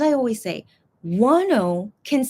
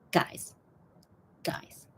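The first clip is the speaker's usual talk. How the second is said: whisper; 11 dB quieter than usual. Real, not acted.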